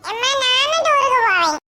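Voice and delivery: put-on a kid pleading to tag along; eager child.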